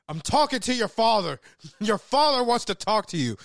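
loud, high-pitched acted scolding; mock angry parental yelling; yelling mom. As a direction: imitate a fed-up mother shouting at her kid. Retells mom yelling at daughter.

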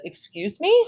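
high-pitched, taken-aback question; kind of shocked. A narrator's reading. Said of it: "said Mom, kind of shocked".

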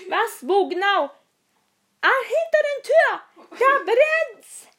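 high, loud dramatic voice; melodramatic child. Acted. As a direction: overact a rescue scene. Playing dialog with dog Lassie.

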